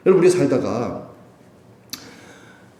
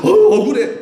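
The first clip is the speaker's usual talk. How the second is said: high, loud mock whine; mocking a believer who feels wronged, high whining; mock complaint; 13 semitones higher, 6 dB louder than usual. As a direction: whine it with a laugh. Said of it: "그런 걸 우리가 하는 거".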